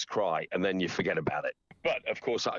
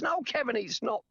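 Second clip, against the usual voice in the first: correcting woman voice. Retold or imitated. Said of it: "She says".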